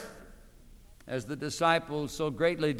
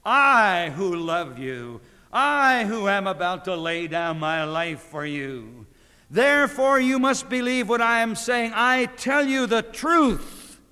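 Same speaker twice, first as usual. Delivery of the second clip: fervent preaching; 5 semitones higher, 6 dB louder than usual. Acted. Preacher voicing Christ to his listeners, fervent. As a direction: proclaim it as Christ's own plea.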